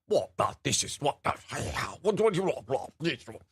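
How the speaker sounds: a hint of a huffy British parent; British outrage. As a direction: fumble the words like an affronted Brit. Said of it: "a very British kind of outrage".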